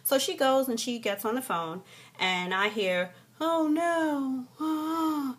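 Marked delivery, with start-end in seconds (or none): 3.4-5.4 high, breath-catching retold dismay; hammed-up worried "oh no"; mock dismay. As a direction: act out overhearing bad news. Overheard attendant on the phone.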